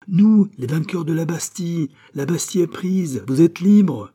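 high, loud victorious cry; triumphant proclamation. A narrator's reading. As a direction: announce great news to a captive. Rescuers proclaim victory to prisoner.

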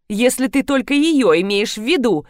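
ordinary delivery

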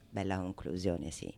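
usual speaking voice

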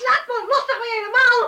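high, loud cry while pulling free; woman fighting off a grabbing stranger; struggling shout. Acted. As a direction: shout it as you fight him off.

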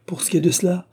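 high-pitched hostess's retort; sharp riposte. A narrator's reading. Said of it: "riposta l'hôtesse". (riposte vive)

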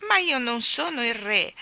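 hurt and sulky; offended grumble. Acted. Boy grumbling back at his nanny, offended. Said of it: "egli brontolò offeso".

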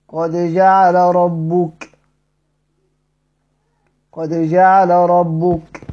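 slow recitation of جعل ربك with lam merged into ra. Shown as a demonstration. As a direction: say جعل ربك slowly as ja'ar-rabbuk, lam swallowed into a doubled ra. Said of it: "أنا أقرأها إزاي؟".

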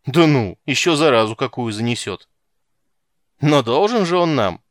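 coarse, loud guardswomen mocking; rowdy loud jeering. A narrator's reading. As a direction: yell crude jokes like a rowdy soldier. Rowdy guards jeer, shouting.

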